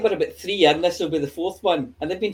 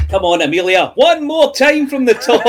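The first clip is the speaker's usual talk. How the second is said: mock director; 7 semitones higher, 8 dB louder than usual. Teasing a co-host to redo.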